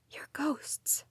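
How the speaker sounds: soft low whisper. A narrator's reading. Daughter whispering to her ghost parents. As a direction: repeat it in a hush. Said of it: "I repeated in a low whisper".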